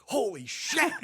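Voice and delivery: high startled yelp of holy shit; "Holy shit." squeaked up high in alarm; shocked holy shit. Retold or imitated. Kids spotting the cop car, high shocked yelp. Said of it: "we're like".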